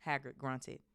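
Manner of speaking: plain reading voice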